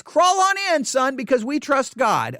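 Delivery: high, blithely encouraging parent; mock trusting parent. Father sending son into a rattlesnake hole, mock-blithe. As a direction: urge the child on, absurdly confident.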